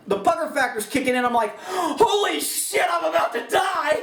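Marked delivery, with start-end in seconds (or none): 1.7-4.0 high, slow panicked exclamation under fire; panicked outburst. Retold or imitated. Shooter under fire panics, high and stretched out. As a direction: blurt it out like bullets are flying. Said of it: "If I'm really jacked up".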